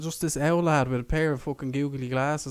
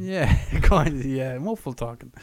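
ordinary delivery

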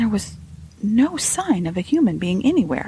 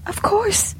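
flustered, high maid's voice; high, hesitant reply; stammering; 14 semitones higher than usual. A narrator's reading. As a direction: falter nervously as the servant girl, pitched high. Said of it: "she stammered".

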